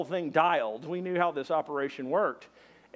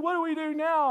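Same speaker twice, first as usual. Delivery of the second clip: high-pitched mock alarm; panicked question; 10 semitones higher than usual. Friend stranded on top of the truck, mock panicked. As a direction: ask it high and helpless.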